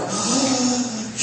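sharp high gasp of wonder; delighted parent's gasp. Pretend. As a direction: gasp as if the baby just walked. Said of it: "we're just like".

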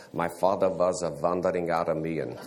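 imitating the old professor's heavy Dutch accent, "wandering" said as "vandering". Imitating the Dutch professor's favorite phrase, in his Dutch accent. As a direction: put on a thick Dutch accent, with w turned to v: "vas a vandering Aramean". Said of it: "heavy in his Dutch accent".

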